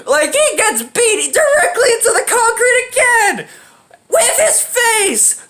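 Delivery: very high, squealed disbelief; incredulous excitement. Real, not acted. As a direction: squeal the climax in hysterical amazement. Incredulous climax of crash story.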